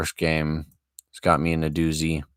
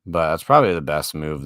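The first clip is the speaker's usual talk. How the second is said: ordinary talk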